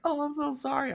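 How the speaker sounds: high-pitched imitation of a girl apologizing; apologetic girl. Imitating the apologetic girl who crashed.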